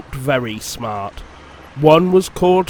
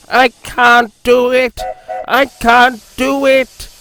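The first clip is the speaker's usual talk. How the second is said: higher, louder despairing grumble; grumbling; 8 semitones higher, 5 dB louder than usual. A narrator's reading. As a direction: give up in frustration. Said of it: "he grumbled".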